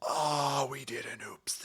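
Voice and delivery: whispered mock-guilty confession; sheepish whisper. Courts confessing they created life by accident, whispered sheepishly. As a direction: breathe it like a guilty secret.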